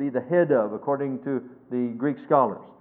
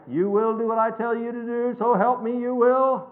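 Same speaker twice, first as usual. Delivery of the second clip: higher, mock-ranting boss; mock screaming maniac; 7 semitones higher than usual. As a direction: play a ranting tyrant, half in jest. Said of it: "not some screaming maniac".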